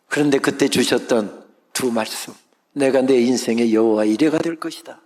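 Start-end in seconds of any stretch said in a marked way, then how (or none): 2.7-5.0 proclaimed promise. Acted. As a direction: declare it like a vow from heaven.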